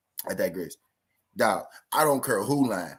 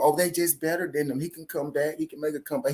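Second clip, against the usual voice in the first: mocking excuse-makers; 6 semitones higher than usual. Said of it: "gonna be a built-in excuse".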